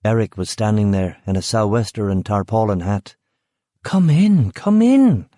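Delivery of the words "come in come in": high, loud put-on welcome; faked concern. A narrator's reading. Rachel greeting Eric at the door, loud fake concern. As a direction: gush a fake worried welcome. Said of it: "faking concern as best she could".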